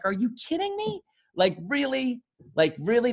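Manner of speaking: high disbelieving are you kidding me; exasperated disbelief at a tweeting president; incredulous. Real, not acted. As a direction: scoff in disbelief, pitch shooting up.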